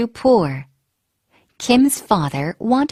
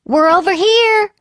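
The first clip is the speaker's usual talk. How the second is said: higher, louder taunting shout; yelling; 9 semitones higher, 4 dB louder than usual. Acted. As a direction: shout to draw the guards away. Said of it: "they yelled".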